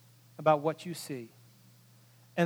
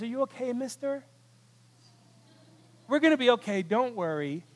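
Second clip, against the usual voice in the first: put-on soft, raised-pitch girl's voice; hushed, higher voice of a caring teenager; reassuring teenage girl; 4 semitones higher, 4 dB quieter than usual. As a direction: gently check on a frightened stranger. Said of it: "fifteen-year-old teenage girl sitting next to him".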